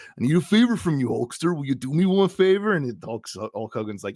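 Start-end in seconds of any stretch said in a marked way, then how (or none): 0.1-2.7 strained wrestler voice, rising high; Macho Man. Retold or imitated. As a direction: ask it as a hammy imitated wrestler. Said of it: "which is he just goes".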